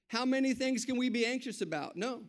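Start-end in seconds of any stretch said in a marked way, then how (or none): none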